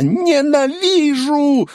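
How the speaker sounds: long high drawn-out scream; shriek. A narrator's reading. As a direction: scream it out in rage. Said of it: "с криком ударила". (протяжный визг ненависти)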